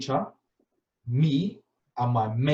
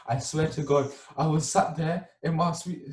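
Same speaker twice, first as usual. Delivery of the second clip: ordinary talk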